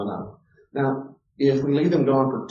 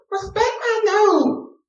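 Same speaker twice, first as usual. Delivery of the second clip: very high, slow mock little kid voice; four-year-old; 5 dB louder, much slower than usual. Four-year-old throwing the rule back at parents, high and slow. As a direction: play a bossy little kid. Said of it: "have a little four-year-old go".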